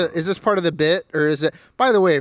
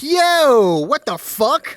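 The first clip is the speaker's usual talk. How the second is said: loud, high "Yo" of disbelief; shocked disbelief; 6 semitones higher, 5 dB louder, much slower than usual. Retold or imitated. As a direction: react with loud indignant shock. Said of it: "And I'd be like".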